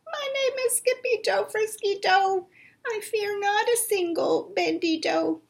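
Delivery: high and soft, trying to be brave; high soft small-dog voice; muy soft voice. Acted. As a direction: say it softly though your knees shake. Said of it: "in a muy, muy soft voice".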